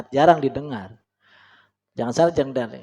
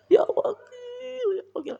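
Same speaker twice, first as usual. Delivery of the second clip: much higher, crying plea; mock weeping prayer; 4 dB quieter than usual. Shown as a demonstration. Preacher imitating a weeping supplicant, mock sobbing. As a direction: wail it like tearful begging. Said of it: "nangis-nangisan".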